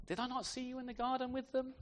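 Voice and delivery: high-pitched, suspicious query; questioning. Acted. Relative of the injured man challenges Peter, high questioning.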